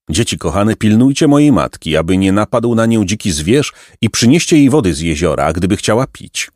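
ordinary delivery

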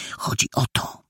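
hushed, whispery confiding. A narrator's reading. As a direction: drop to a secretive near-whisper. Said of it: "Jola ściszyła głos".

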